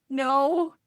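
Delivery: put-on high child-like no; small voice quoting a child; retold child's reply. Retold child answers parent's question.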